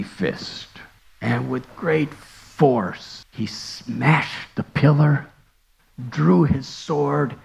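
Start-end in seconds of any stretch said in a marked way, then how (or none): none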